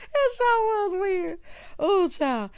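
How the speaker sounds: high laugh into the words; squealing laughter; laughing hard. Real, not acted. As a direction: giggle it out high. Laughing reply.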